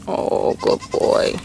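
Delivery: tender whisper of praise. Real, not acted. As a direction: whisper it slowly, very high and soft. Cooing at goat.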